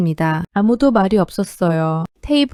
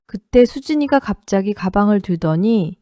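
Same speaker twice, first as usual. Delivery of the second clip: usual speaking voice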